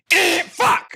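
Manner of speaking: sudden very high, loud cry of fright; startled yell. Retold or imitated. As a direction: jump and yell in shock. Startled by the bell.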